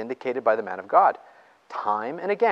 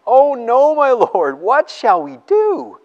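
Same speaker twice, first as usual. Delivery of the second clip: high, loud panicked cry; a terrified servant begging his master; alarmed servant pleading, much higher and louder; frightened servant; 12 semitones higher, 8 dB louder, slower than usual. Acted. Frightened servant in read story.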